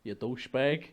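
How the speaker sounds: Boston accent. Shown as a demonstration. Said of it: "really good fucking Boston accent".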